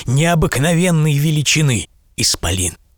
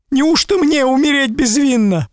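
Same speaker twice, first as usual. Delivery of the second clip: weeping merchant; 13 semitones higher, 4 dB louder than usual. A narrator's reading. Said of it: "плакал купец".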